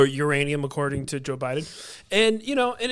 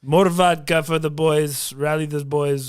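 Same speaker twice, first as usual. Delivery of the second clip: slow, hammed-up Russian accent for Putin; mock Russian commander rallying his troops. Comic imitating Putin rallying the boys, Russian accent. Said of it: "my Russian accent".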